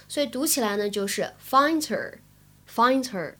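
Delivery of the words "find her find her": "find her" run together with the H silent; dropped H. Shown as a demonstration. Teacher showing learners the sound change, H dropped in "find her". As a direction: slowly link "find her" as "find-er". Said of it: "就会感觉这里字母H没有发音".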